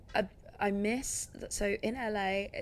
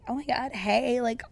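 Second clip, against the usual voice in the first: higher, bubbly fake enthusiasm; gushing LA voice; 6 semitones higher than usual. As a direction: gush like an overeager Angeleno. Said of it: "They are so".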